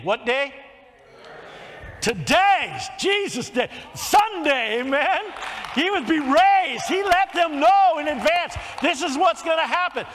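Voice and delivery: builds from a call to the crowd into a high, loud shout; preaching at full force. Acted. Preacher shouting to his congregation about the resurrection, rising to full force.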